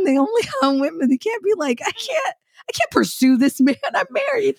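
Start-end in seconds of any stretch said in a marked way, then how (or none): 1.9-4.6 prim mock refusal.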